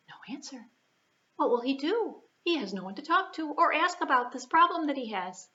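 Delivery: plain reading voice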